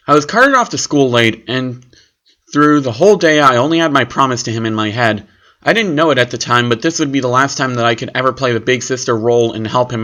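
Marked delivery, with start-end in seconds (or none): none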